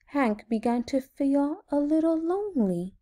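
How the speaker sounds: usual speaking voice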